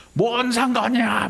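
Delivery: high, drawn-out mock child's retort. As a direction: talk back like a child in a huff. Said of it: "너 왜 성질 내". (아이가 성질 내며 대들듯)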